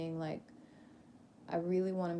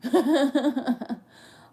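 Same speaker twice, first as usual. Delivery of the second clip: loud, higher-pitched laugh; amused laugh; 6 semitones higher, 11 dB louder than usual. Real, not acted. Joking about high vibing tears, amused laugh. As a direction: burst out laughing at your own phrase.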